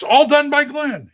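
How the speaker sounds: high, loud, slowed announcement; bill it like a stage show; mock grand announcer. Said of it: "the stage version of the Ten Commandments".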